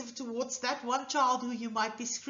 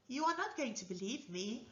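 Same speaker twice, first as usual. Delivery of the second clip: ordinary delivery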